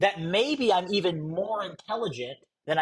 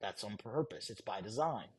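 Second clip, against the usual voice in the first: much lower and softer, deliberate; low deliberate emphasis; 9 semitones lower, 14 dB quieter than usual. Shown as a demonstration. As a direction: drop the voice to make it land. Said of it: "slowest that I had spoken".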